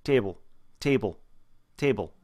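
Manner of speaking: Boston "table" with almost no diphthong; short, nearly pure Boston vowel in "table"; Boston accent. Shown as a demonstration. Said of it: "sort of the Boston accent".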